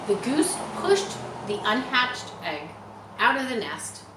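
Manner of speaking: usual speaking voice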